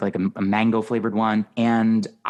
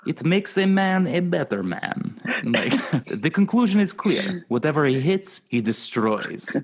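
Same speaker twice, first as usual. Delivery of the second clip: Whoopi Goldberg hype man; 5 semitones higher than usual. Pretend. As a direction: pump up the fighter like a hype man. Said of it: "Whoopi Goldberg delivering those lines".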